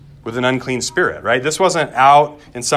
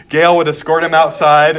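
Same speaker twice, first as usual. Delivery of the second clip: ordinary talk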